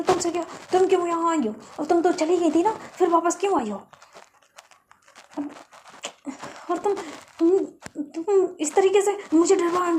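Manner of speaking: loud, high, rapid telling-off; angry scolding. Retold or imitated. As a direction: tear into her sister for the scare. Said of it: "चिल्लाती है". (गुस्से में ऊँची आवाज़ में डाँटना)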